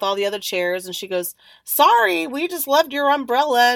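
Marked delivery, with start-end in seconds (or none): 1.6-3.7 high, loud apologetic exclamation; acted apology. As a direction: imitate a lady calling out a sorry.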